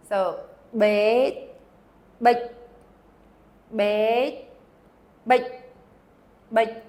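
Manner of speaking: quick clipped bite, said over and over; fast short bite. Shown as a demonstration. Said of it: "but it has to be fast".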